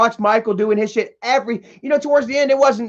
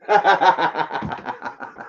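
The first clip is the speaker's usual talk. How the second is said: low mocking laugh; 4 semitones lower, 3 dB quieter than usual. Real, not acted. After taunting Michael.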